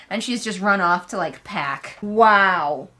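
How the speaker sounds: ordinary talk, nothing marked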